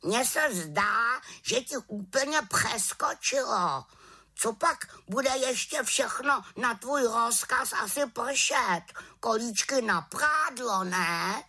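high-pitched scolding bird; croaking raven. A narrator's reading. Raven mocks the witch's botched spell, scolding croak. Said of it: "krákal havran".

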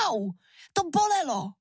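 high, sudden yelp of complaint; high-pitched indignant ouch; pained cry out. A narrator's reading. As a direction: cry out sharply as the hurt queen. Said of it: "vykřikla královna".

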